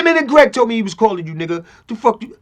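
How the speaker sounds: high, loud comic imitation of a caller. Friend calling [name] to ask what's up, comic loud imitation. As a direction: demand answers down the phone, high and loud.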